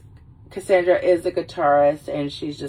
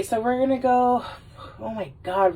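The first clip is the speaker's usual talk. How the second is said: ordinary talk, nothing marked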